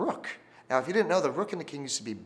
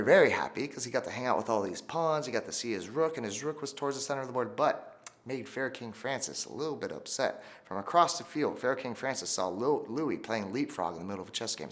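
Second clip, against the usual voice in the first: ordinary talk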